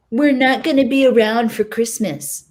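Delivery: mock the girlfriend's whiny excuse; unflattering Maddie mimic. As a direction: sneer out her excuse in a snooty imitation. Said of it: "I mimic Maddie's voice in an unflattering way".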